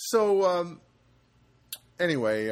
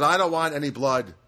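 firm, louder mock refusal; insistent refusal; 7 dB louder than usual. Patient refusing blood at the class, firmly. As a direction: put your foot down, imitating her. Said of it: "And so she goes".

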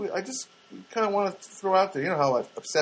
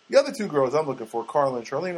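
normal talking voice

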